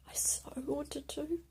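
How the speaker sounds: slow, broken whisper; hushed slow confession. Real, not acted. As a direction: confess it barely voiced. Confessing suicidal urge.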